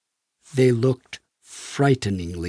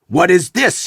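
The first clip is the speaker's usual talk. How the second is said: shouted question; 5 semitones higher, 5 dB louder than usual. A narrator's reading. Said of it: "he shouted".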